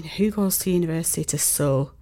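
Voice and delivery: whispered aside. Real, not acted. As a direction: breathe it softly.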